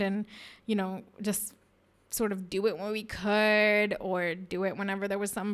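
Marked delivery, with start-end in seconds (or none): none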